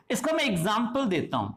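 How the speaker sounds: ordinary talk, nothing marked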